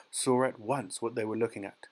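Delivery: usual speaking voice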